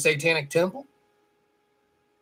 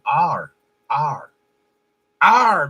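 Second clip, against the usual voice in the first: pirate voice; 5 dB louder, much slower than usual. Retold or imitated. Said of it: "like a pirate".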